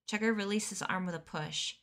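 usual speaking voice

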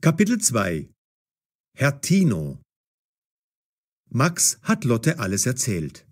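ordinary delivery

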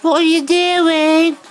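very high, loud squeal to a dog; pet talk. Pretend. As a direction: gush at a little dog in a piercing squeak. Greeting the dog in baby talk.

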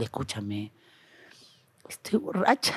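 very soft acted aside to a friend; hushed confession. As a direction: confide it under your breath, playacting. Confides drunkenness to friend in story.

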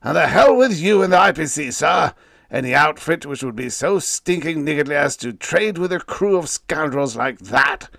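furious shout. Acted. Captain curses the company and its trade plans, shouting. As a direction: blast him with contempt. Hansen rages at vice president.

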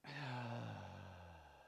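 long, falling sighed exhale; drawn-out audible breath of release; letting-go sigh. Shown as a demonstration. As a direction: sigh out slowly to let it go. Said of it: "and let it go".

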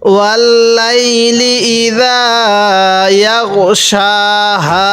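slow, drawn-out tajweed chant; Quran recitation. Shown as a demonstration. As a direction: recite each word slowly with long vowels. Teacher recites verse after spelling.

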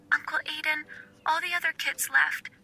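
put-on a lonely little girl trying not to sob; tearful child. Wendy tells Uncle Aiden nobody picked her up, soft and tearful. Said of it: "Wendy cried".

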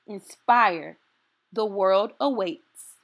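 ordinary delivery, nothing marked

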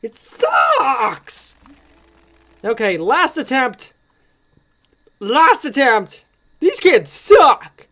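real exasperated shouting; frustrated rant. Real, not acted. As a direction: vent frustration at the game. Frustrated ranting at game.

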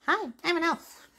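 high, soft elf voice; christmas elf. Shown as a demonstration. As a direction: chirp it like a tiny elf. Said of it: "Elf sound".

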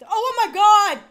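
high, loud cry of shock; startled scream. Real, not acted. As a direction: yelp as if something jumped out. Startled by horror game scare.

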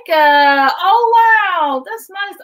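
high, loud impressed reaction; delighted surprise. Real, not acted. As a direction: burst out, pleasantly surprised by the news. Reacts to learning viewer is from Jamaica.

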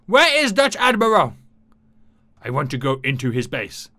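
usual speaking voice

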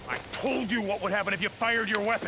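high, loud furious scolding; shouting at a reckless partner; angry yelling. Acted. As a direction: chew him out at full volume. Quarrel after he fired weapon.

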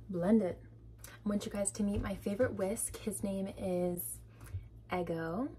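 normal talking voice

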